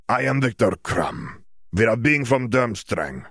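put-on slow, heavy Eastern European accent; Bulgarian accent. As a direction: speak slowly in Krum's Bulgarian accent. Krum introduces himself.

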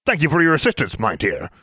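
high put-on voice, dropping at the end; posing as a man. A narrator's reading. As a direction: thank a subordinate condescendingly in disguise. Disguised Alexandra takes leave as Mister Brown.

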